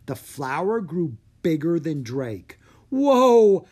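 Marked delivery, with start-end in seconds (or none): 2.9-3.7 loud, high astonished whoa; amazed exclamation. A narrator's reading. Drake seeing the giant flower, loud amazed whoa. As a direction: gasp it out in wonder. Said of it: "Drake exclaimed".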